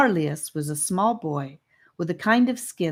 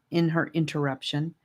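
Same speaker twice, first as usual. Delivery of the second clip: plain reading voice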